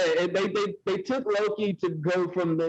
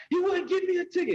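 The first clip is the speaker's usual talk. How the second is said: mimicked tattling, pitched way up; high-pitched complaint; 13 semitones higher than usual. Acted complaint quote from film.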